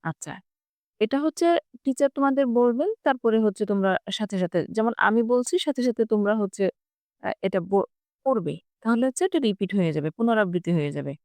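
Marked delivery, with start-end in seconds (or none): none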